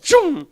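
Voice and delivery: high, loud "tchum"; sudden explosive swoosh of being yanked; whoosh sound effect. Shown as a demonstration. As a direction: burst out a vocal whoosh. Imitates being yanked forward.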